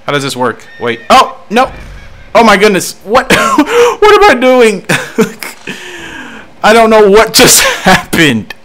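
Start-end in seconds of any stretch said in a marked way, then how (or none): 1.1-8.5 loud, high, helpless laughter through the words; laughing panic. Real, not acted. Player baffled by what just happened, laughing high and loud. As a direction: squeal in shock, cracking up. Game goes wrong, player cracks up.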